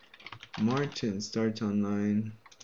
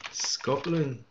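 higher, badly faked Scottish accent; attempted Scottish accent; 4 semitones higher than usual. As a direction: have a poor go at sounding Scottish. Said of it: "That was not a Scottish accent".

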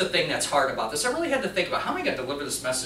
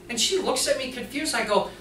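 ordinary talk

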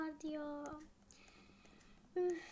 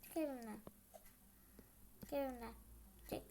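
quiet order; 4 semitones lower, 8 dB quieter, faster than usual. Acted.

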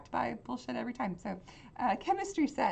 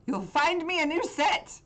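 angry order; 8 semitones higher, 5 dB louder than usual. Acted. Chemist orders Rob out.

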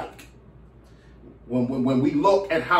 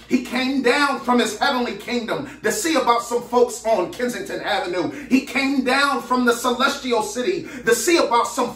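preacher's rhythmic, higher-pitched refrain; fervent preaching; 4 semitones higher than usual. Real, not acted. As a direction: build a rolling sermon cadence, pitch climbing with each repetition. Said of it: "I feel like preaching now".

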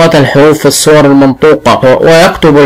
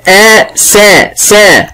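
"آ", then "سا", each higher and held apart; sound-by-sound breakdown; 4 semitones higher, much slower than usual. Shown as a demonstration. Teacher splits أسد into sounds, slow and segmented. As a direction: slowly isolate "آ" and "سا" as separate sounds. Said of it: "أنا هحلل الكلمة إلى أصوات".

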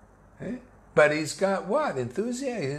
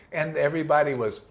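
ordinary talk, nothing marked

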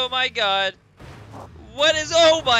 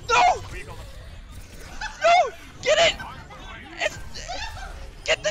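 excited shout; faster than usual. Real, not acted. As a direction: yell at the screen. Shouting at the game outcome.

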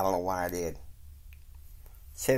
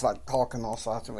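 ordinary delivery

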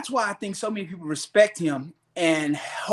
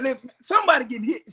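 quick, high mock alarm; 8 semitones higher, faster than usual. Teasing the coach by mimicking his rushed alarm, fast and high. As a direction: rattle it off like you're sure a fight broke out.

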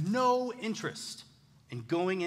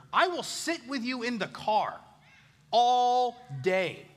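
stern threat to daughter; 6 semitones higher, 5 dB louder, slower than usual. Retold or imitated. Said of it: "willing to say to her".

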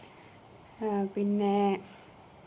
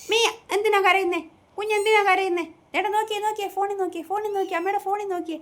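put-on very high, louder cooing baby talk; baby talk to pet; 11 semitones higher, 5 dB louder than usual. Owner soothes crying Miya, high cooing baby talk. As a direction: coo to a crying kitten like a mother.

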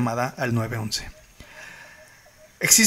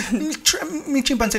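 panicked caller; 10 semitones higher, slower than usual. Retold or imitated. Retold frantic 911 call.

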